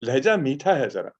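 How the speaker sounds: retold praise, lifted in pitch; quoting an elder poet. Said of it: "Faiz looks at me and he said".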